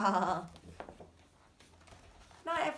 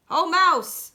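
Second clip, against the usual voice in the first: loud, high friendly hare greeting; 6 semitones higher, 10 dB louder than usual. Acted. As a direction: greet an old friend brightly. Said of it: "says Hare".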